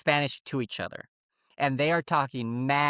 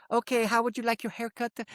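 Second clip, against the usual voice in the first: higher, sweet customer-service voice; big fake smile; 7 semitones higher than usual. Haircutter turns to the customer in English, sweetly smiling. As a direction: ask it through a big put-on smile. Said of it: "puts a big old smile on her face".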